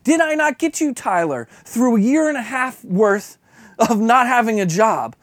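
impassioned preaching rebuke; God's rebuke. Retold or imitated. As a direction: hammer home what God has done, rising in force. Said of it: "When he says".

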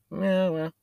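high mewing cat voice; high cat meow. Retold or imitated. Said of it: "That's what it's saying".

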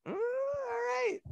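very high, soft, slow reluctant okay; hesitant half-approval; grudging acceptance. Retold or imitated. Rewatching viewer reluctantly accepting the brick, slow and soft. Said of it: "I'm like".

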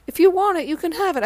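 lightly voiced Charlie being generous; imitating Charlie.

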